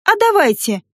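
bright, eager burst of agreement. Acted. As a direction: jump at the chance. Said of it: "азартно согласилась я".

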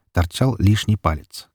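plain reading voice

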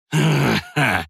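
short dark laugh; a brief menacing chuckle. A narrator's reading. Knight laughs mid-threat.

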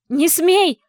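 shrill high scream, very loud. A narrator's reading. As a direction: scream it in a fury. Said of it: "Алиса сорвалась на крик".

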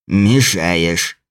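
low annoyed leprechaun voice; grumpy low little creature. Acted.

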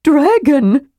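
high, awestruck burst; amazed high-pitched gasp of a word; astonished exclamation. A narrator's reading. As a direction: finally get the stunned word out. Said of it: "the word came out".

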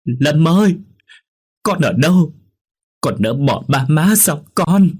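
high, loud wail of a grieving mother; anguished, drawn-out howl; crying and screaming. A narrator's reading. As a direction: cry out at full voice for a lost son. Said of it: "vừa khóc vừa gào lớn".

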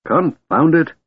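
high, exasperated outburst; exasperated snap. A narrator's reading. Half-elf pulling his hand from old man's grip, exasperated. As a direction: snap it out, pitch jumping. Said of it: "Tanis snapped in exasperation".

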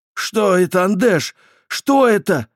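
panicked high shout; yelling. A narrator's reading. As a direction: scream it in terror. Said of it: "проорал Франк".

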